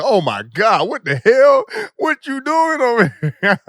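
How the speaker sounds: high, loud outraged shriek; mock shocked shriek. The family catches them, a loud high shriek. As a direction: shriek like the whole house erupted. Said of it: "They was like".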